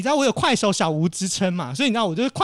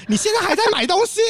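much higher, shocked question; a startled clerk who can't believe it; 12 semitones higher, 4 dB louder than usual. Retold or imitated. Shop clerk shocked the traveler is still shopping, high astonished question. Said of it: "他吓傻，他说".

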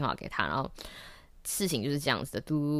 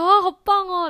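high, loud "哇，好棒哦"; much higher, louder delight; thrilled; 8 dB louder than usual. Retold or imitated. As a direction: gush like the plan just worked. Pleased after the surprise.

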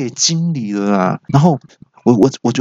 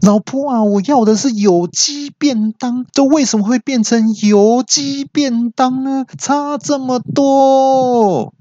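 angry yell; slower than usual. Retold or imitated. Husband yells at wife over the greasy chicken, high outraged whine. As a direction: howl in outrage over the wrong lunchbox. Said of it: "我气到大叫".

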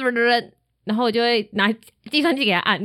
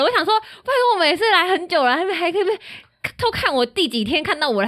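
ordinary talk

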